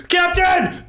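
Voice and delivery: high, loud panicked call; crew shout. Acted. Crew calls the captain as water floods in, high shout. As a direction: yell for the captain across the deck.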